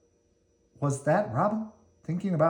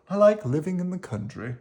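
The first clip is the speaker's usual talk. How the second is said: gently raised worm voice; lightly voiced Squirmy; 6 semitones higher than usual. Squirmy replies to Slimy, in a lightly raised gentle voice. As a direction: answer back kindly.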